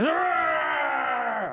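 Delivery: high, drawn-out monster roar; dragon roar. Acted. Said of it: "the dragon goes".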